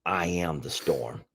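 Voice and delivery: slow, soft, hushed reply; gentle hushed quote; soft retold whisper. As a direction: say it low and slow, like a secret. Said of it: "And she whispered back".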